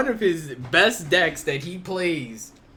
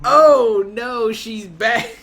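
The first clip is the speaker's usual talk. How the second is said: mock dread; 6 semitones higher, 9 dB louder, much slower than usual. Mock dread at deck returning.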